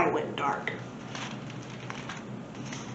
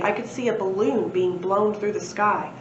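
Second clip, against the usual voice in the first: ordinary delivery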